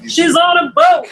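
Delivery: high, loud mock announcement; hammed-up, high-pitched exclamation; playful shout. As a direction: call it out big like a catchphrase. Playful catchphrase called out.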